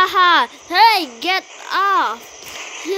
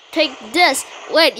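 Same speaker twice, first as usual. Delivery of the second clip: ordinary talk, nothing marked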